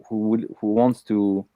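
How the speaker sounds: ordinary talk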